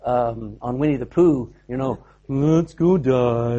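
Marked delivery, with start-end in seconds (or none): 2.3-3.6 slow, glum Eeyore-like drone; a lightly voiced mopey Thomas; gloomy Eeyore. Thomas grimly urging the disciples on, slow and glum. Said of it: "Thomas is like Eeyore".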